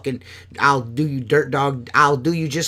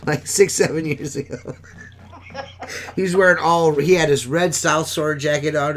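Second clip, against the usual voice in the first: usual speaking voice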